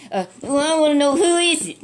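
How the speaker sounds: high, loud demand to know who it is; demanding king. Retold or imitated. King grilling his men, demanding loud and high. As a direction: press hard for the traitor's name. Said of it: "he demanded to know".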